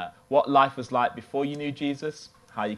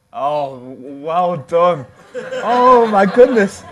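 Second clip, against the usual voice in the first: loud laughing burst, much higher; shouting through laughter, high and loud; 7 semitones higher, 13 dB louder, much slower than usual. Real, not acted. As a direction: laugh out loud in delighted disbelief.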